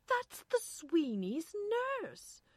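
loud, high, drawn-out realisation; astonished recognition. A narrator's reading. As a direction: blurt it out amazed. Irene startled at recognising voice.

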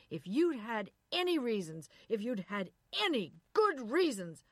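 loud accusing tirade; furious shout. Acted. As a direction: hurl every accusation at her. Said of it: "shouted at her from there".

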